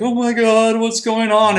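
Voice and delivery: high-pitched imitation of a panicked wife; high, drawn-out mock alarm. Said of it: "his wife is like".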